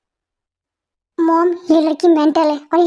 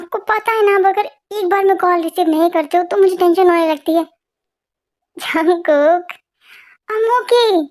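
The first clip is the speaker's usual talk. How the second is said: normal talking voice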